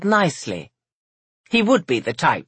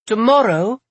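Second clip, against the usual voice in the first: higher, rising echo of disbelief; surprised question; 6 semitones higher, 4 dB louder than usual. A narrator's reading. Healer hears the general's offer to return Eviana, surprised. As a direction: catch at the word, pitched up into a question. Startled by his sudden offer.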